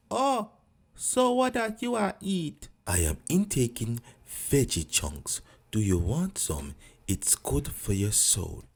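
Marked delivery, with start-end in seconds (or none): 2.9-8.6 lazy low Rasta greeting; low laid-back Rasta; deep Rasta voice. Acted.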